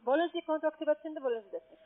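ordinary delivery

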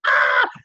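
short high, loud scream; acted scream. Patient re-enacting the emergency room chaos, acted scream. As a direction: let out a shrill panicked "Ah".

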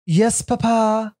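slow, high, very posh British Yes, papa. Retold or imitated. Mocking posh Brits for a co-host, slow and high. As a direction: stretch it out like an upper-class British child. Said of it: "really posh".

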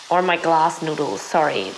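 usual speaking voice

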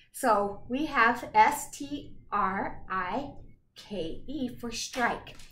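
"S T R I K E" spelled letter by letter, slowly; slow spelling for the class. Shown as a demonstration. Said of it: "spelling it out".

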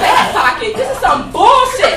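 loud, high outraged squeal; indignant shriek at the doctor; mock outrage, loud and high. Outraged at doctor's fat pocket.

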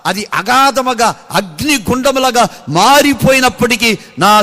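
full-throated pulpit cry; preaching at full force. Acted. Preacher voices testimony climax.

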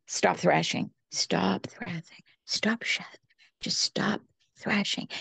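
soft, hushed whisper, slowing down; calm, peaceful voice. Retold or imitated. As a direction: whisper it gently, like an angel calming a drowning child. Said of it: "calm, peaceful way".

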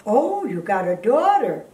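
ordinary talk, nothing marked

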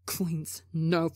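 ordinary delivery

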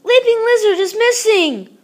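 high, loud alarmed cry; panicked. A narrator's reading. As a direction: blurt it out in panic. Child panics, frog is missing.

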